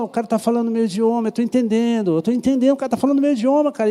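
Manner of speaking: fast, high, amazed rush of words; mock astonished bystander, high and fast; excited onlooker. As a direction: blurt it out like a stunned witness who suddenly understands. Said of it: "E eles falam".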